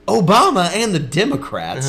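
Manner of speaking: mock radio pundit, big dramatic swings; Glenn Beck. Brother imitates host Dad listened to, booming radio voice. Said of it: "it was the same thing".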